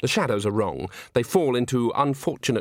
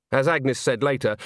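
ordinary delivery

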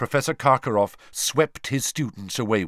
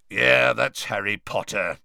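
growling voice; 3 dB louder than usual. A narrator's reading. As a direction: growl it out like a gruff old soldier. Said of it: "said a growling voice".

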